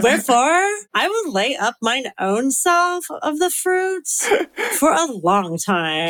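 put-on slow Valley girl with vocal fry; Valley girl accent. Shown as a demonstration. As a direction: draw it out like a Southern California Valley girl. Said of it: "I kinda like this accent".

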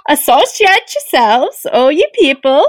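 lilting, botched Swedish-like reading; Swedish-sounding urchin accent. Acted. Co-host attempts an urchin reading, lilting Swedish-sounding accent. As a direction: swing the scripture up and down in fake Swedish. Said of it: "you're sounding more like Swedish".

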